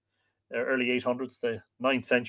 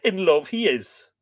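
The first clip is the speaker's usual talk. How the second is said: higher-pitched grumble; mildly scornful, pitched up; grumbling; 6 semitones higher, 6 dB louder than usual. A narrator's reading. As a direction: grumble it as a jibe. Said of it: "Conan grumbled".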